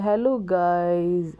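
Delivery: imitating a sleepy child, drawn out very slowly. Mother imitating her sleepy son's slow greeting to viewers, very slow. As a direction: stretch the words out like a drowsy kid. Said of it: "ऐसे बोल रहा है".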